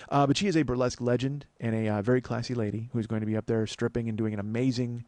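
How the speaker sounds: ordinary talk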